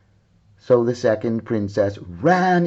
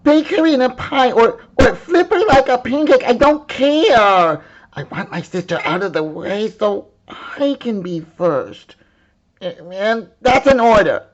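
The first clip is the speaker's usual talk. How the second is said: high, loud commanding princess; furious princess ordering the cook about; bossy order; 11 semitones higher, 6 dB louder than usual. Acted. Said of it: "she said".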